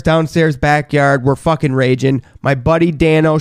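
normal talking voice

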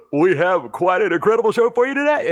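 fast, high cheesy host; game show host voice. Retold or imitated. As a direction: rattle it off like a TV host. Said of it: "he's like".

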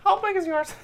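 teasing. Real, not acted. Teasing question.

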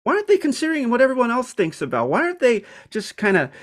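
imitating her own baffled irritation; exasperated inner voice. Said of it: "And you're like".

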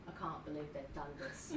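put-on Cockney accent. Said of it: "David is just like".

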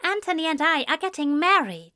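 high, quick, happy announcement; grinning. A narrator's reading. Man tells his friend he is getting married, grinning. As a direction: say it high and fast through a grin. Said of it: "grinned as he said".

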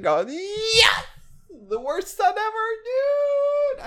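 very high squealed cry; mock wail. Imagining his parents hearing the podcast, high mock wail. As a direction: squeal it out in comic despair, stretching the last word. Mock wailing about being worst son.

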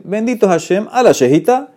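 high, ringing declaration of the blessing; emphatic blessing. Shown as a demonstration. Teacher proclaiming the blessing to his class, high and emphatic.

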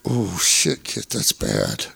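low, slow grave murmur; grim doctor voice. Retold or imitated. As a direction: drop the voice low and deliver bad news slowly. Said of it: "And he goes".

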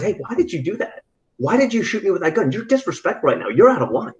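loosely imitated celebrity telling someone off; Tom Cruise. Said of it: "if you ever get to see Tom Cruise".

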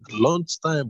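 ordinary delivery, nothing marked

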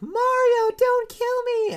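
high, loud terrified cry of a sleeper; mock a boy screaming in a nightmare; panicked sleep yell. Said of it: "Dax was in his sleep and he was like".

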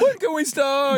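high, wobbly Seinfeld impression; nervous yodeler. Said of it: "you sound like a nervous l-, yodeler".

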